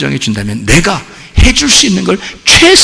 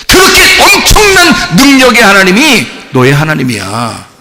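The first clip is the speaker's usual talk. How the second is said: ordinary talk, nothing marked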